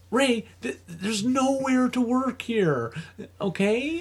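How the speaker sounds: high, very slow, spelled-out explanation; slow explaining. Retold or imitated. Telling Ray there's no work, slow and high. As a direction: explain it like to a child.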